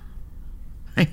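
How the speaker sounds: breathless laugh. Acted. Caught lying about Mengele.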